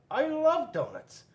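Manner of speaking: a small critter keen on the pink donut; childlike penguin voice, slow and bright; high eager penguin. Acted.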